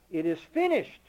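loud, declared outright to the congregation; ringing proclamation. Acted. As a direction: proclaim it like a verdict. Preacher proclaims Christ's last words.

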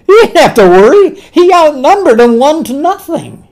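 loud, high, excited outburst; exultant preaching. Real, not acted. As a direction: crow it triumphantly to the congregation. Preacher exulting over Peter's advantage.

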